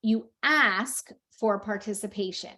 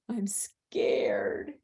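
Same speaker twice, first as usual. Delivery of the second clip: whispered pretend fear; hushed play-scared whisper; mock frightened whisper; 4 dB quieter than usual. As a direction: whisper it as if hiding from a monster. Mom acts scared, replayed.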